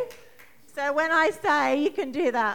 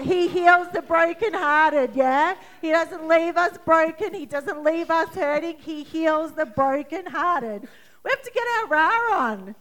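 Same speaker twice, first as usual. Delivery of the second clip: fiery preaching; 4 semitones higher, 6 dB louder than usual. Acted. Preaching with fire.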